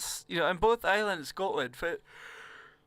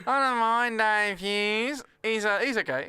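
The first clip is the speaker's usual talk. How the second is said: slow, higher, drawn-out comedian impression; Dave Hughes; 4 semitones higher, 4 dB louder, slower than usual. Comic rating a fellow comedian, slow put-on drawl. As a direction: drag every word out in mimicry. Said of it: "Do you like Dave Hughes?".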